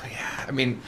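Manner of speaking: hushed resigned whisper. Real, not acted. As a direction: whisper it like a secret rule.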